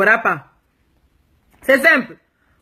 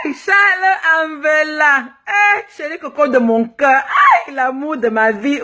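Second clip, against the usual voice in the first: drawn-out, higher hammed-up call to her sweetheart; loving call-out; 5 semitones higher, slower than usual. Calling out to her far-away husband Charles, hammed-up and loving. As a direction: stretch out his name like calling across the ocean.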